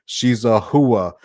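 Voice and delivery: slow on hua, mock Jersey warning; a hammed-up Jersey buddy gossiping; Jersey Italian accent. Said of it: "the full Jersey style".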